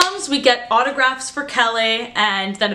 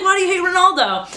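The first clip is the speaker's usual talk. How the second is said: mock indignant; 9 semitones higher, faster than usual. Retold actor's playful protest.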